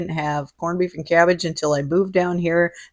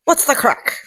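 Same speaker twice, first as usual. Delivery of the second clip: high, "What's the crack?" with a light Irish lilt; Irish slang greeting; 8 semitones higher than usual. Retold or imitated. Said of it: "I said it to you".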